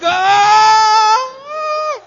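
long, very high, loud squeal; mock shriek of happy surprise; delighted scream. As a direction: scream as if just given a huge gift. Acting out delighted surprised recipient.

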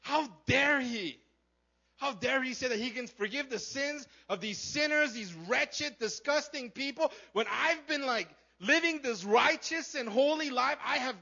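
high, loud, indignant mock rant; pissed-off Pharisee. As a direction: rant in self-righteous outrage, hammering key words. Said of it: "this pissed him off".

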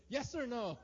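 loud, high mock-frantic demand; demanding an answer. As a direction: insist like an anxious controlling person. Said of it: "they have to have an answer".

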